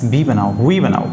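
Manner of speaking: ordinary talk, nothing marked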